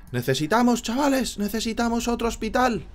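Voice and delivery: high, loud urgent call; excited plea. Real, not acted. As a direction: beg the viewers in a panic. Panicking about infected villagers. (súplica exaltada)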